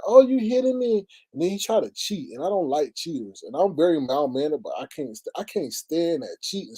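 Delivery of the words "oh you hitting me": high, louder mock whine; put-on aggrieved complaint; whining complaint. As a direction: imitate a crybaby player protesting. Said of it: "I told him to stop crying".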